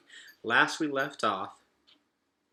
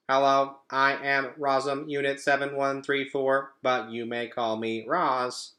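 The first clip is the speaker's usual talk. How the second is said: even, mechanical robot greeting, slowing at the end; automatic robot voice; 4 semitones higher, 5 dB louder than usual. Acted. Robot greets her son automatically in an even, mechanical voice. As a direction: recite it like a machine. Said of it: "The robot spoke these words automatically".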